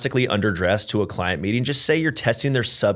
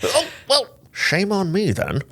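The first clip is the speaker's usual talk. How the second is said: put-on British accent; 11 semitones higher, slower than usual. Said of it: "we break into British accents".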